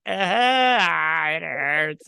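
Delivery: loud, high drawn-out wail of pain; mock pained cry. Punched in the nose at a party, mock wailing. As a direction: wail it out, hamming up the hurt. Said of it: "I was just like".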